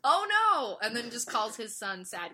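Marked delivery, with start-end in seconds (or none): 0.0-0.8 mock panic.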